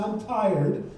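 normal talking voice

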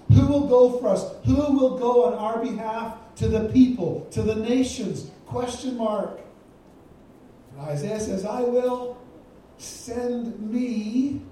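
forceful preaching. Acted. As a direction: ring out God's call to the nations. Voicing God's call and Isaiah.

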